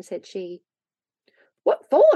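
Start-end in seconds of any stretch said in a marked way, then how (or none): 1.6-2.2 high, loud burst of amazement; amazed shout. A narrator's reading. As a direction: shout it out, astonished. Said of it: "shouted Joel in amazement".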